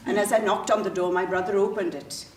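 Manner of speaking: ordinary delivery, nothing marked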